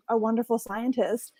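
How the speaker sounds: ordinary delivery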